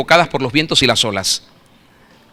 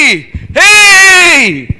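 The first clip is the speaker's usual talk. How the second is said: high, loud panicked cry; frightened yell; 12 semitones higher, 7 dB louder than usual. Shown as a demonstration. Disciples crying out when they see the figure. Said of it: "con voces de miedo".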